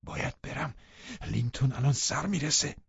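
hushed, anxious urgency; frightened. A narrator's reading. Unwanted guest fearing husband's arrival, frightened and hushed. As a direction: whisper it in alarm. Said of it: "مهمان ناخواسته ما هراسان گفت".

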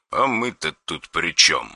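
soft, cold menace; quiet threatening caller on the phone; dry harsh voice. Acted. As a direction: threaten without raising the voice.

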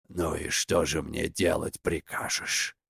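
low, soft, resigned question; glum grumbling. A narrator's reading. Svarog asks Veles what to do, glumly muttering. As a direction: grumble it deep and under your breath.

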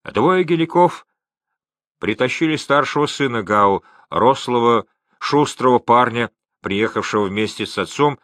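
plain reading voice

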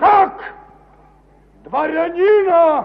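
outraged shock. Acted. Ivan Ivanovich aghast at the servants, high and slow. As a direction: gasp it out, scandalised.

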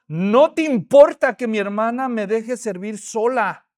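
loud, slow, indignant reproach; insolent complaint. Acted. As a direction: scold him for leaving you alone. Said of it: "es insolente".

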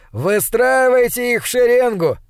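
high, called-out order; brash command. Acted. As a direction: call out the order to line them up. Narrator acts a brash command. (задорная команда, высоким голосом)

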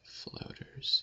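soft whispered word; whispering. Real, not acted. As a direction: whisper it to yourself. Whispered while typing.

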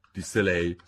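ordinary delivery, nothing marked

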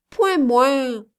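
high, loud begging; child's pleading. A narrator's reading. Little girl begging to be lifted up, pleading. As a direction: beg like a small child to be picked up. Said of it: "supplia-t-elle".

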